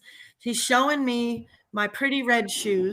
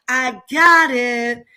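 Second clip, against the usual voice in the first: slow, louder fed-up repeat; exasperated shout; 7 dB louder, much slower than usual. Retold or imitated. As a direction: stretch it out, sick of being told. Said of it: "Like, uh".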